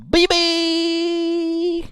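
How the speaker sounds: very high, long-held BB; slow, soaring high announcement; drawn-out name call. Real, not acted. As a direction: stretch the name out in a sky-high squeal. Drawn-out greeting to a chat member.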